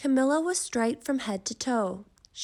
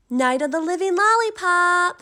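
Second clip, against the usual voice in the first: higher, louder mocking chant; taunting kids; 9 semitones higher, 10 dB louder than usual. A narrator's reading. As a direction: jeer like schoolkids.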